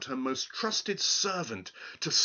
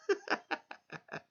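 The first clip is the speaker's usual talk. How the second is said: high, quiet impish cackle; gleeful cackle; 4 dB quieter than usual. Acted. Little man gloating over his secret, high cackle. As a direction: cackle at your own cleverness.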